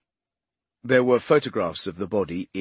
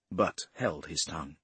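usual speaking voice

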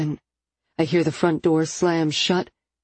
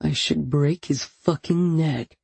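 seething; 2 semitones lower than usual. A narrator's reading. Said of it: "he seethes".

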